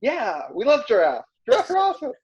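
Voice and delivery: excited. Retold or imitated. Family replying in quoted excitement.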